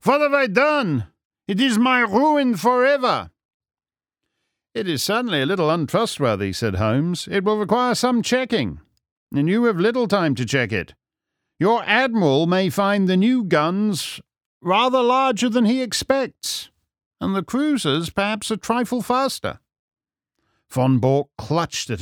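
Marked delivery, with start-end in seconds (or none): 0.0-3.3 put-on high, anguished German-accented wail; distraught villain, pitched way up; despairing cry. A narrator's reading. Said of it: "he cried". 4.7-19.6 high, airy detective voice; lilting, theatrical sleuth; dry, needling Holmes. Acted.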